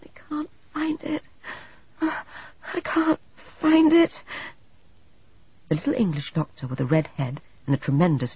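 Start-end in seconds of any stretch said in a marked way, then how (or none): 0.0-4.2 delirious whisper into a drawn-out slow moan. A narrator's reading. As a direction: moan it like a feverish patient. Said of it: "continued to moan".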